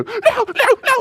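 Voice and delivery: squeaky high rushed no no no; panicky kid. Retold or imitated. Son fleeing from his dad, squeaky panicked no. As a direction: yelp it like a scared kid running.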